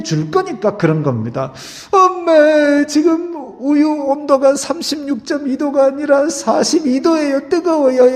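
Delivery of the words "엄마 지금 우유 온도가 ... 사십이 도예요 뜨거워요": high, mock-precise baby voice; a baby politely reporting milk temperature; baby talking. Preacher mocking a baby explaining too precisely. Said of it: "이렇게 얘기하는 애기는 없어요".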